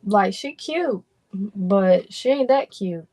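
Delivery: ordinary delivery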